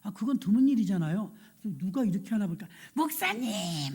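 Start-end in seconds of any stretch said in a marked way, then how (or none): 2.9-3.9 drawn-out imitated holler to the pastor; calling out. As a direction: hail the pastor joyfully from a car, stretching the word. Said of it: "막 그래". (길게 빼며 목사님을 부르는 흉내)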